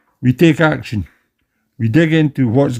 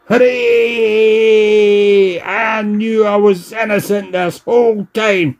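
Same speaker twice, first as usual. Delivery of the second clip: excited cheer; 6 semitones higher than usual. Acted. Cheering his innocence.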